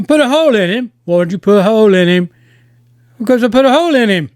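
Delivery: a hint of a heavy Irish accent; lightly voiced Irish gangster. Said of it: "I can't do a big, heavy Irish accent".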